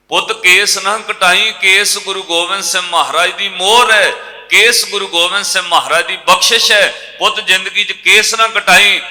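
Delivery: loud, high preaching of a mother's plea; preached plea. Acted. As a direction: proclaim the mother's words at full force.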